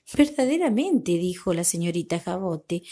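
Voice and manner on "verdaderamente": a touch of haughty indignation, pitched high; mildly scandalized, high-pitched reaction; indignant sister. A narrator's reading. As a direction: exclaim it as a lightly voiced offended sister. Haughty sister refuses to lend dress.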